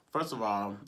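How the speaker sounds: ordinary talk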